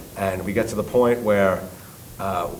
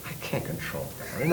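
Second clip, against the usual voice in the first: hushed, dropped-voice confession; quiet worried aside; 13 dB quieter than usual. Retold or imitated. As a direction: mutter it under your breath. Retold panicked admission.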